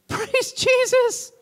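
very high, slow praise; ecstatic praise. Retold or imitated. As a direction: burst out in praise. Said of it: "Gary says to him".

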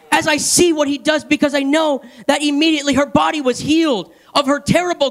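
full-voiced, insistent church exhortation; fervent preaching. Real, not acted. Preacher urging the congregation toward immediate healing, fervent. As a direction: preach with rising urgency and drive.

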